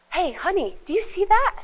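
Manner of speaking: calling a partner to look, rising eagerly; eager bid for attention. Shown as a demonstration. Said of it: "when you say".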